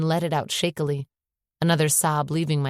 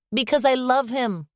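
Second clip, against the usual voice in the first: shouted; 9 semitones higher than usual. A narrator's reading. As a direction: yell it at her father in frustration. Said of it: "I shouted".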